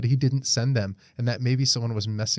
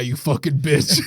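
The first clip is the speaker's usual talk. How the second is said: mock threat; 5 dB louder than usual. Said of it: "he's like".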